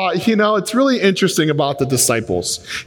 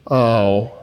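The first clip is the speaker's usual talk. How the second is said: deflated oh; 6 semitones lower than usual. Retold or imitated. Seatmate deflated by the reveal.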